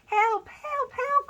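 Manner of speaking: high, loud frantic yelps; panicked cry for help. A narrator's reading. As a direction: shriek for help in terror. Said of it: "cried the hippo".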